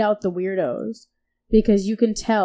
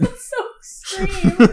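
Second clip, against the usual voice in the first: laughing; 11 semitones higher, 3 dB louder, much slower than usual. Real, not acted. Laughing reaction.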